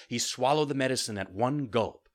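plain reading voice

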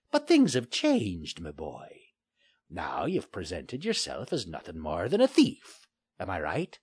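put-on a taunting old sea pirate; gruff pirate. Pirate Finley voiced.

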